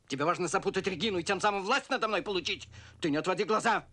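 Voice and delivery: angry accusation. Acted. Man confronting his partner over Регина, angry. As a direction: press the accusation hard and loud.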